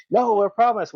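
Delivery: high, earnest protest; retold pleading. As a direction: reenact kids insisting they belong. Retelling a plea to get in.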